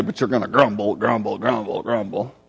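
deep, quiet muttered grumble, grumble; low grumbling. Shown as a demonstration. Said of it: "a low undertone".

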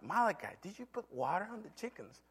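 high, soft retold question; questioning father. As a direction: ask the child lightly, pitch lifted. Father repeats question to son.

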